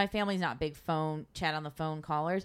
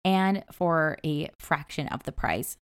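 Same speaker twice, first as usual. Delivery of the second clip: ad read; 7 dB louder than usual. Acted. Host reads sponsor ad.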